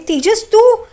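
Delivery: high, rising disbelief; acted gasping question with pitch leaping up; shocked recognition. Renuka discovers it is Tejas, acted shock.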